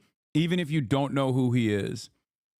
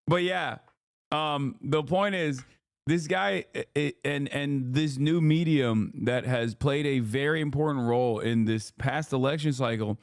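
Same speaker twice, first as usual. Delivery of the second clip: ordinary talk, nothing marked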